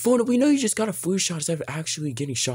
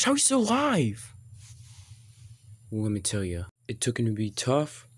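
usual speaking voice

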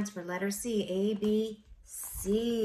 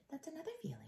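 plain reading voice

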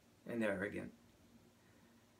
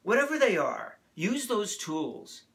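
loud, high rallying cry to the congregation; fervent sermon climax; impassioned preaching; 8 semitones higher, 8 dB louder than usual. Real, not acted. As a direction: drive the charge home at full force.

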